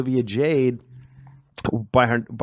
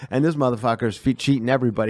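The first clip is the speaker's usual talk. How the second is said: ordinary delivery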